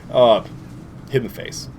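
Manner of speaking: usual speaking voice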